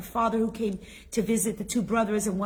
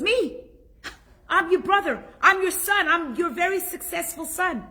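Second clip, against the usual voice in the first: higher, louder boast as the rich son; boastful rich son; 9 semitones higher, 5 dB louder than usual. Retold or imitated. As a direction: puff up as the proud successful son.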